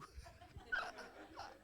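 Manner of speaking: airy high-pitched giggle; high breathless laugh. Real, not acted. Preacher laughing at his wife's reaction, in a high airy laugh. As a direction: laugh it off, half in disbelief.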